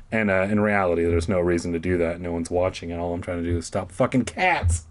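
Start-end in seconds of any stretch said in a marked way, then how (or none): none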